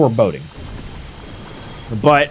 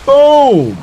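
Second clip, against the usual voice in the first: high, loud, drawn-out exclamation; big celebratory gamer call; triumphant shout; 10 semitones higher, 6 dB louder than usual. Real, not acted. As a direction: holler it as the hit connects. Landing a hit on the boss.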